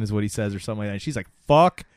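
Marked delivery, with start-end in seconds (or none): 1.5-1.8 louder defiant curse; mother defying Satan, mock angry; mock snarl. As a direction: spit it at him.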